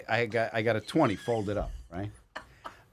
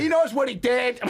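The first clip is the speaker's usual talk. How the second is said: mock yell; 14 semitones higher, 5 dB louder than usual. Uncle yelling line retold.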